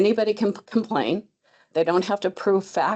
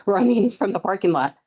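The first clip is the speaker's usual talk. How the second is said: usual speaking voice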